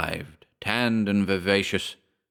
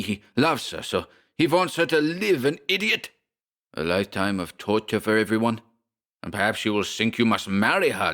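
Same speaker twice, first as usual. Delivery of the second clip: German rage; 2 semitones higher than usual. Acted. Schmersholt whirls on Briggs, furious German accent. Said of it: "The little German whirled on him".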